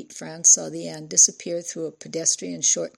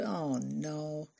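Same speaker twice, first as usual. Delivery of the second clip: groaned; 9 dB quieter than usual. A narrator's reading. Father hears his daughter took off, groaning. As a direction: sink into a weary groan. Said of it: "Ralph groaned".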